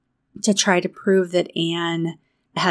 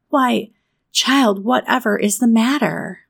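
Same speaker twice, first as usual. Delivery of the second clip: higher, surprised voice of a stern guardian; startled concern; 6 semitones higher, 4 dB louder than usual. A narrator's reading. Marilla sees Anne suddenly crying, startled concern. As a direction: ask in alarm what is wrong.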